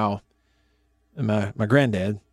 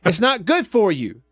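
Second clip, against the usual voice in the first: stern little daughter; 8 semitones higher than usual. Retold or imitated. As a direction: imitate a bossy eight-year-old laying down the law. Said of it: "almost in this tone".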